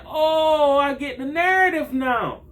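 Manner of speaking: sarcastic high-pitched realization; mock realization. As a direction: act like it all just clicked, sneering. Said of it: "I said".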